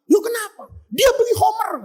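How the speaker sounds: high, shouted back-and-forth; acted heated quarrel. Tabrizi and mob arguing over wine, high mock shouting. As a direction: fire question and accusation high.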